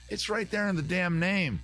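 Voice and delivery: normal talking voice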